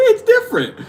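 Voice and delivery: high and laughing through it. Real, not acted. Joking that it is funny and laughing high. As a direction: break into laughter on it.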